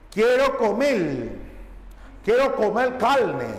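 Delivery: "comer carne" as "comel calne", every R before a pause or consonant turned to L; Central American R. Shown as a demonstration. Teacher demonstrates phonetic variation, L for R in "comer carne". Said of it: "En Centroamérica no pronuncian la R".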